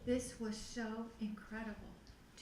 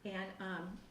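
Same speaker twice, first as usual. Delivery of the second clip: ordinary talk, nothing marked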